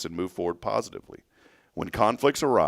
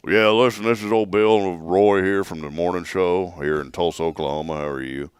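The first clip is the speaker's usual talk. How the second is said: prank caller persona; 2 semitones lower, 4 dB louder than usual. Pretend. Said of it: "No, I'm just kidding".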